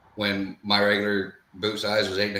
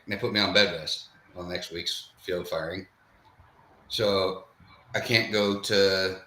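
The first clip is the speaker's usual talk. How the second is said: ordinary delivery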